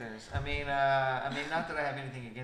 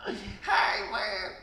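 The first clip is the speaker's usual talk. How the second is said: hammed-up Southern yokel voice; Larry the Cable Guy; 7 semitones higher, 7 dB louder than usual. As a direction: strain it out like a cartoon hillbilly. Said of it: "Fucking Larry the Cable Guy".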